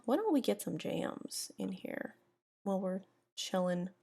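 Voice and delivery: ordinary talk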